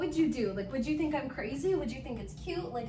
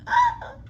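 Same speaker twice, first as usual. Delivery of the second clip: piercing high yelp of realisation; a sudden loud squeal of surprise; shocked shriek; 10 dB louder than usual. Retold or imitated. Vlogger acts out learning she has ADHD, a shocked shriek. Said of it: "And I'm like".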